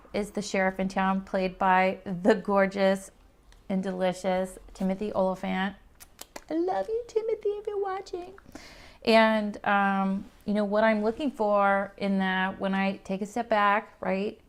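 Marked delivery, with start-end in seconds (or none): 6.5-8.3 high, breathy swoon. Real, not acted. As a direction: gush like a fangirl. Gushing at actor crush.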